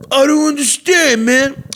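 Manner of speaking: high, strained mock bar-guy voice; imitating the Italian guy. Retelling the loud Italian guy.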